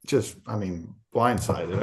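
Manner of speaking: ordinary talk, nothing marked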